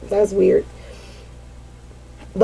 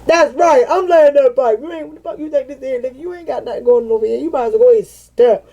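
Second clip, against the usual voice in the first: higher, swaggering tough-guy voice, half laughing; mock tough guy; 4 semitones higher than usual. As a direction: brush off a rival with a cocky, playful swagger.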